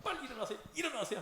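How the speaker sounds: urgent waking. Acted. Disciples rousing sleeping Jesus in the storm, mock urgency. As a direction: imitate an urgent plea to wake up.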